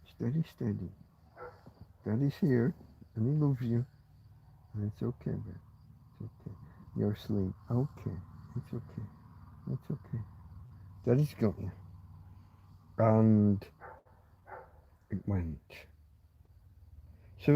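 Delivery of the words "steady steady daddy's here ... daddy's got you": soft, gentle hush to a little one; calm her down tenderly, almost whispered. Real, not acted. Calming Tina, who is asleep.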